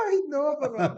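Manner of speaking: high, loud pleading wail with a laugh; laughing, hammed-up cry of a scared girl; frightened cry. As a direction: beg in mock terror, laughing through it. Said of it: "yo gritaba".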